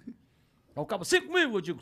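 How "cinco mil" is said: high, loud auction shout; shouted rival bid. Retold or imitated. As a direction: call out a bid across the room. Acts rival bidder shouting a bid. (lance gritado do concorrente)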